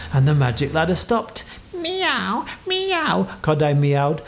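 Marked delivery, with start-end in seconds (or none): 1.7-3.3 very high little cat mews; pretend-scared meowing. A narrator's reading. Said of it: "still pretending to be scared".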